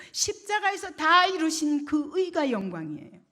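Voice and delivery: high, loud sermon proclamation; impassioned preaching. Real, not acted. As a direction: drive each clause home to the congregation.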